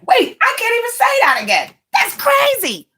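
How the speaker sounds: incredulous. Real, not acted. Incredulous at own words.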